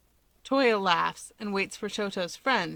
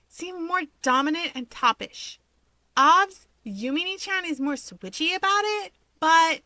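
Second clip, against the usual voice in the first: put-on much higher, excited girlish chatter; bubbly gossiping girl; 9 semitones higher, 6 dB louder than usual. Himiko teasing the brothers about their siblings, bubbly gossip. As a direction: play the giddy gossip spilling secrets.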